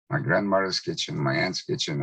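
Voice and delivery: usual speaking voice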